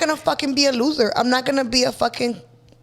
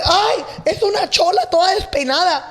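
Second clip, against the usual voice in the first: higher, put-on gossip voice; gossiping coworkers; 7 semitones higher, 3 dB louder than usual. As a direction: sniff at her like nosy coworkers. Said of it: "they'll be like".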